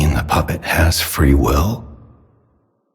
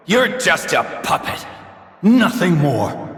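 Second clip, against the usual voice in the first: high, strained voice spitting out contempt; a father's high-pitched disgust at a mere puppet; bitter rejection; 13 semitones higher than usual. Acted. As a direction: scorn him from the top of your voice.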